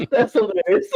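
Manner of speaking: high-pitched laughing; giggling through the words. Real, not acted. Laughing at the clip.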